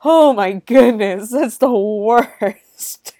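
loud, drawn-out dismay; horrified reaction. Retold or imitated. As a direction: exclaim it slowly with exaggerated horror. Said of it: "and I'm like".

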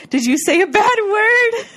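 high, loud, drawn-out hammed-up question; mock scandalized. [name] quizzes the ghost, mock scandalized squeal. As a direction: squeal it slowly, imitating shocked disbelief.

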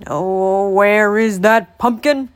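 put-on loud, slow old man's croak. A narrator's reading. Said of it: "croaked the old man".

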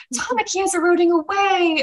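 high, thin mock voice of a girl watching; piping up in awe; background girl voice. Girl watching Tamaki crumble, high mock voice. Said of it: "one of them goes".

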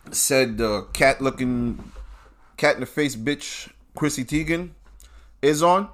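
usual speaking voice

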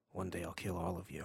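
low, soft aside in imitation; mock menace, muttered low and quiet; under his breath. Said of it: "under his breath, he'll be like".

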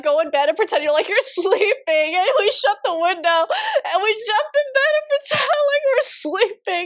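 high-pitched, giggling through the words; laughing while telling. Real, not acted. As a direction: barely get it out for laughing. Cracks up retelling getting caught.

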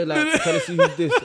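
high, laughing through the words. Real, not acted. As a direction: giggle while telling it. Funny story, laughing.

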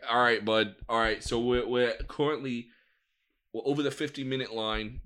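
usual speaking voice

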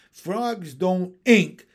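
loud, slow retort; exasperated scream. A narrator's reading. Frog wife corrects her husband, loudly exasperated. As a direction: hammer each word loudly. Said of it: "I screamed".

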